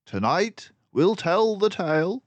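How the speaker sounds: high, loud, brisk detective voice; a clever mouse sleuth, pleased with himself; Basil the mouse detective. A narrator's reading. Narrator voicing Basil to Dawson, high and loud.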